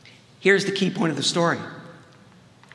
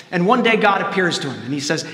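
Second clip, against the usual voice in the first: normal talking voice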